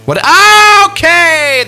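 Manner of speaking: sudden loud surprised yelp; startled shout. Real, not acted. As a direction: caught off guard, high and loud.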